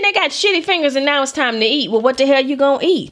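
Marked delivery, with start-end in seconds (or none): none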